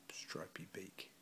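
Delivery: plain reading voice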